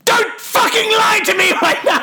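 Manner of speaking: high-pitched mock-furious shout; imitated angry outburst. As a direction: yell it in hammed-up fury. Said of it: "he very angrily goes".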